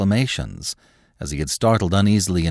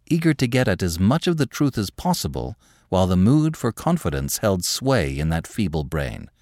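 ordinary delivery

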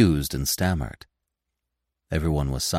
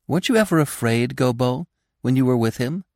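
put-on young doe voice; 8 semitones higher than usual. Feline's questioning voice.

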